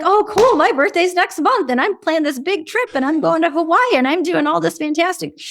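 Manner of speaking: high, loud self-absorbed bragging over them; conversation hijacker. Retold or imitated. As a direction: grab the talk and gush about yourself. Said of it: "conversation hijacker".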